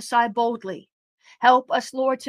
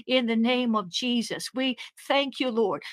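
usual speaking voice

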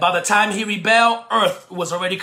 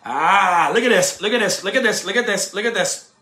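ordinary talk, nothing marked

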